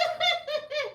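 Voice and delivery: quick high laugh; short laugh. Real, not acted. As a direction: laugh at losing it. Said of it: "clearly I have lost my mind".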